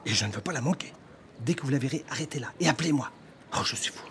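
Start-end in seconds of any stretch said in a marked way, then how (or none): 3.5-4.0 whisper. Acted. Ashamed man scolds himself.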